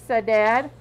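usual speaking voice

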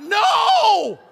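high, loud panicked cry; freaking-out mom. Retold or imitated. Mom seeing the baby fall, loud high cry. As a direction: scream it like a mom watching the cradle fall. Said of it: "Any mom's, like, freaking out".

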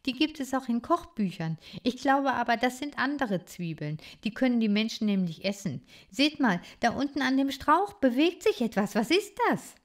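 put-on a curious young critter speaking up; lively little hero. Bodo voiced by reader.

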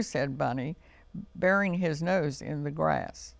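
ordinary delivery, nothing marked

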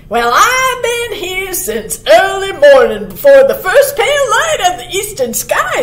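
high, loud cartoon muskrat; munching muskrat. A narrator's reading. Muskrat reporting on his early morning watch, high and loud. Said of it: "said the munchin' muskrat".

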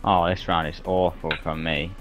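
ordinary talk, nothing marked

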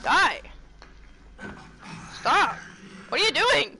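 loud, high yells mid-fight; frantic yelling. Real, not acted. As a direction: yell at the attacker, voice jumping up.

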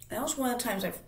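normal talking voice

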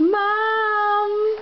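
high, cooing modelled word; high-pitched. Shown as a demonstration. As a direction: coo it back high to the child. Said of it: "Can you say".

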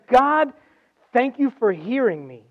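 high, loud call on God, then easing; fervent prayer. Retold or imitated. Preacher voices Jesus thanking God amid grief, fervent prayer. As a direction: cry out the thanks with preaching force.

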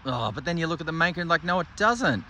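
ordinary delivery

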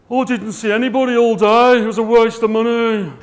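complaining exhibitor. Retold or imitated. Exhibitor moaning at the end of the show, loud and aggrieved. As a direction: moan that the show was a waste. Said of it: "at the end of the show go".

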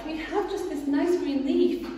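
small and meek; tiny ants. Acted. Ants answering the rat, tiny and meek. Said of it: "the ants replied".

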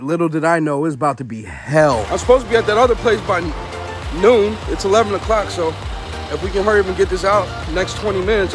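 usual speaking voice

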